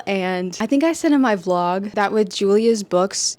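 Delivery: usual speaking voice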